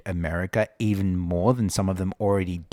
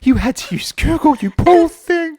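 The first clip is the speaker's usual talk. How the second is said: very high, loud mocking squeal; mock pity; 7 dB louder than usual. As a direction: feign horrified sympathy in a squeaky put-on voice. Mocking the interviewee's Google excuse.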